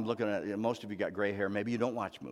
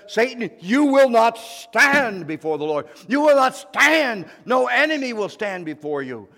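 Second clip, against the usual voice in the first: commanding declaration; 13 semitones higher, 15 dB louder than usual. Acted. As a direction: rebuke Satan with full authority. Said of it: "and says".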